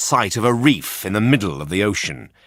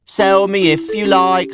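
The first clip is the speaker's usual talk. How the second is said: very high, louder cheeky puppet voice; a bright wooden puppet teasing; puppet boy voice; 9 semitones higher, 5 dB louder than usual. A narrator's reading. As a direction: Pinocchio teasing the man who bought him. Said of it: "said Pinocchio".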